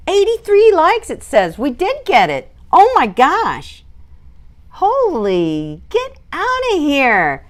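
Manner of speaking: can't believe the likes goal was hit; thrilled disbelief. Real, not acted. Streamer seeing the livestream hit its likes goal, thrilled disbelief.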